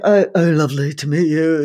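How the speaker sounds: mumbled impression of a distracted singer. Singer imitating her hero meeting her, vague and mumbled. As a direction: slur through a vague, polite greeting. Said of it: "And he's like".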